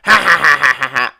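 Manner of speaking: loud, high imitated giggle; cute girl's laugh. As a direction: act out her cute little laugh. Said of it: "she is laughing so cute".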